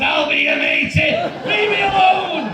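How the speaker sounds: loud, high acted outcry; shouted quote. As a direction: shout it, rising high to a drawn-out end.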